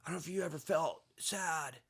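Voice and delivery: lightly done San Diego surfer dude. Retold or imitated. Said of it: "San Diego surfer voice".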